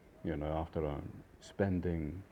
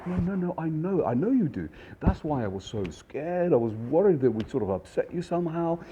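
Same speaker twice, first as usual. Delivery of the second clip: higher, louder imitation of a smooth waiter; effusive reassurance; 9 semitones higher, 5 dB louder than usual. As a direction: lay on the feigned concern. Waiter plays on guests' guilt.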